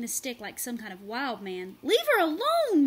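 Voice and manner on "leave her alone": very high, very loud shout; shouted again. A narrator's reading. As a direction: yell it full out. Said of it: "Marshall shouted again".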